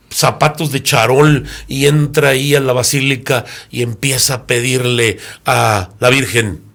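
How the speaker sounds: usual speaking voice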